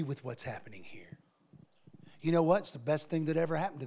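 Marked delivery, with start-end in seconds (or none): 0.0-1.2 hushed, breathy reading of the note; mock whisper. Whispering the departing group's note.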